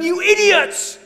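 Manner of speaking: very high, loud tyrant's tirade; furious yelling. Acted. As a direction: bellow at useless underlings, pitch shooting up. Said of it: "he is yelling at everyone".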